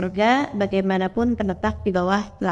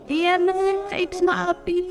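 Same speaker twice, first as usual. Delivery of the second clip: put-on higher, gentle old mother granting forgiveness; old mother forgiving; 7 semitones higher, much slower than usual. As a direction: voice the mother high, letting it go. (suara ibu tua tinggi memaafkan)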